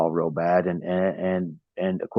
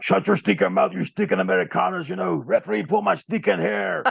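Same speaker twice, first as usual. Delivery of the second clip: Cuban heel voice; 11 semitones higher than usual. Shown as a demonstration. Wrestler shows his Cuban character yelling at the crowd, over-the-top Cuban accent. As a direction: sneer it like a Cuban wrestling villain in a thick accent. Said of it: "Let's hear you do a little bit of it".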